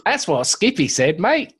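mock Aussie, pitch jumping on the last word; Australian accent. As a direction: sign off in a put-on Australian accent. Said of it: "my Australian news".

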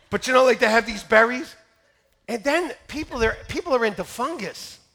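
normal talking voice

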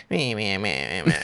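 low, nasal-free mocking meh of a whining kid; whiny mock kid. Imitating the whiny son who complains. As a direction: mimic a whiny child complaining. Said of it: "he's all, like, whiny and fucking complains".

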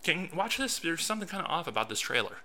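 sly hint to a friend about the trailer; sly, coy. Retold or imitated. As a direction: play it casual to hide the news. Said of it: "I was being sly about it".

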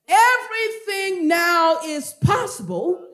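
loud, high proclamation with key words drawn out; full-force preaching. Real, not acted. Preacher announcing the topic to the congregation, full-force preaching.

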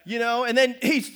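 ordinary delivery

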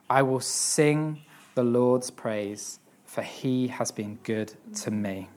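whispered close of the psalm; hushed. Acted. Preacher ending the psalm reading in a slow whisper. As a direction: breathe the last lines, slow and soft.

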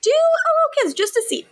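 very high, fast, giggly squeak; high playful squeak; squeaky tease. Pretend. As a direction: squeal it like a teasing kid. Echoing in squeaky voice.

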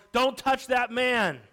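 high, loud warning yell; alarmed yell. Acted. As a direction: yell like a mother protecting her child. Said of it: "A mother quickly grabbed her child and yelled".

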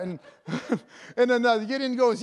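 ordinary delivery

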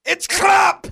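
shouting. Pretend. Mock Scottish shouting.

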